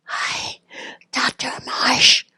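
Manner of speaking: slow, strained whispered greeting; weak whisper. Retold or imitated. Nurse calling the doctor at night, whispering. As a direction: push the words out with no voice. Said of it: "my voice is really low".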